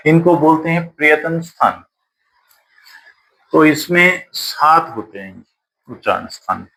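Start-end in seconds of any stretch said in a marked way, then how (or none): none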